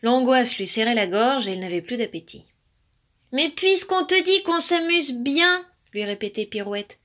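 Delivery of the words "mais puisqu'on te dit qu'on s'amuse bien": high, drawn-out insistence; insistent. A narrator's reading. Pirouette urging anxious Alaric, insistent. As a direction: stretch it out, coaxing and high. Siblings reassuring anxious rabbit.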